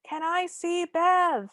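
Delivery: high, drawn-out mock yell; high-pitched pretend shout, held on one note; mock screamy. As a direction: call slowly over a racket. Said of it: "having a screamy conversation".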